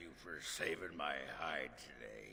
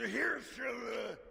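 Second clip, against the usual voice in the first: much higher, louder toast; shouted toast; 5 dB louder than usual. Acted. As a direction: raise the glass and shout it. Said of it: "repeats the toast louder".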